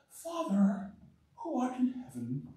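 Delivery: hushed, affected holy prayer voice; put-on pious praying. Preacher mocking hypocrites who put on an act in prayer, put-on pious. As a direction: pray softly with showy, fake reverence. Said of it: "Is that really how you talk?".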